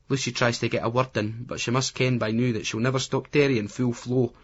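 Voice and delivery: plain reading voice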